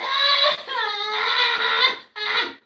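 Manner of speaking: high drawn-out wailing sobs; loud crying. Real, not acted. Misjudged streamer breaking down, sobbing. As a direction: bawl with long wails. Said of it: "I'm crying like this".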